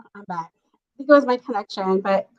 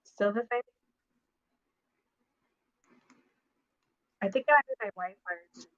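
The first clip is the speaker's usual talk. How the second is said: muffled low audio; slower than usual. Real, not acted. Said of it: "No, it's real low".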